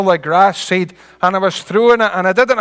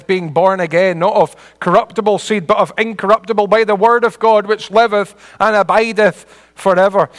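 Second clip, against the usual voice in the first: raised, ringing scripture recital; reciting scripture; 4 semitones higher, 5 dB louder than usual. Real, not acted. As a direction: proclaim the verse to the congregation. Recites scripture while preaching.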